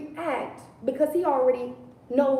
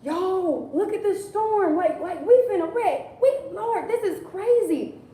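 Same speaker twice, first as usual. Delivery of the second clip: higher, frantic mock-panic; higher hammed-up alarm; panicked disciples; 6 semitones higher than usual. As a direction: play scared disciples in the boat. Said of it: "the disciples are like".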